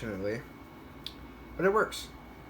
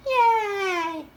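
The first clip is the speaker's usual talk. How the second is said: high squealed yay, loud; playful cheer; 5 dB louder than usual. Pretend. Mock cheering for good radios.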